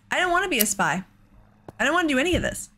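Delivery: usual speaking voice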